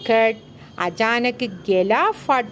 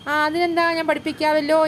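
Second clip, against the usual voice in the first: lightly acted echo, higher pitched; a hint of imitation in a raised voice; mimicking; 5 semitones higher than usual. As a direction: parrot the other boy's words with pitch raised. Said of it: "ആ അനുകരിച്ചുകൊണ്ട്".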